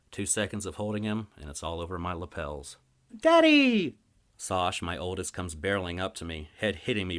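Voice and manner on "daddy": put-on very high, loud child's squeal; excited little kid. Little daughter running to her father, high and excited.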